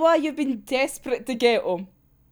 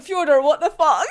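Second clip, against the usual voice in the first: normal talking voice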